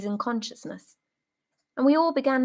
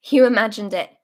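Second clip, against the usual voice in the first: "You imagined it" called out much louder; loud brush-off; 9 dB louder, faster than usual. Acted. Soldier brushing off a comrade's claim, loud and dismissive. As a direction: dismiss it loudly across the table. Soldiers bickering over tea.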